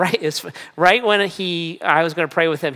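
usual speaking voice

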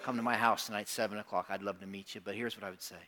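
normal talking voice

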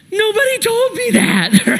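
very high mock-tearful squeal; hammed-up little-kid wail; crying child. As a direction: imitate a bawling boy protesting. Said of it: "and he's crying".

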